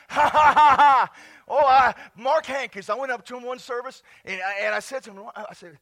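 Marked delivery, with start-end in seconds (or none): 0.1-1.1 loud burst of ha-ha laughter; boisterous pulpit laughter; hearty laugh. Real, not acted. Laughs at own drunk joke.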